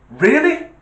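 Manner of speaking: high, loud disbelieving rise; you can't be serious; incredulous. Real, not acted. Storyteller reacting to the police dispatcher, incredulous high rise.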